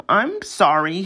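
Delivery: plain reading voice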